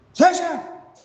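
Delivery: high, loud cry for a friend; desperate call. Acted. Llamando a amigos desde el túnel. (llamado desesperado muy agudo)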